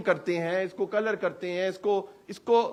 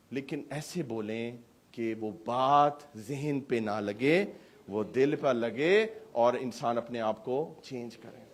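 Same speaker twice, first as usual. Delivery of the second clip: sincere; 6 semitones lower, 5 dB quieter, slower than usual. Real, not acted. Pastor urges heartfelt speech.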